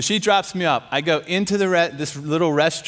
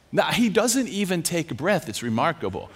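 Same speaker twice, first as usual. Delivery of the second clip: ordinary delivery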